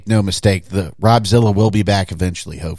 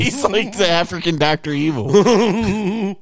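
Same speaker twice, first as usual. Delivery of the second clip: laughing; 8 semitones higher, slower than usual. Real, not acted. Laughing at a photo.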